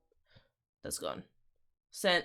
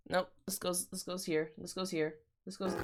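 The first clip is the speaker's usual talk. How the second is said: ordinary talk, nothing marked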